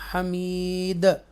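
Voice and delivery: हमीद drawn out slowly with the d bounced; slow recitation of हमीद ending in an echoing d; qalqala on the final d. Shown as a demonstration. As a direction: stretch हमीद and let the d echo. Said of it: "ऐसे पढ़ेंगे".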